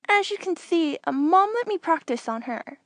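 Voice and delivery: put-on a kid half proud, half embarrassed; bashful young Anakin. Anakin admitting to Tenel Ka he practiced on Mom, sheepish.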